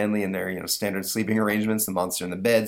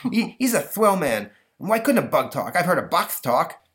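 put-on higher, lisping kid voice; indignant boy Stanley; 9 semitones higher, 4 dB louder than usual. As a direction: protest like a schoolboy with a lisp.

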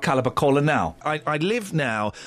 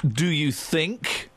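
drawn-out questioning; 4 semitones lower, much slower than usual. Real, not acted. Doubts caller's definition, repeats it drawn out.

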